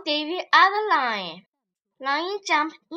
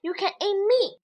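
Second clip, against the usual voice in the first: higher, light rabbit voice; 6 semitones higher than usual. Acted. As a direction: offer it innocently. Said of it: "said clever rabbit".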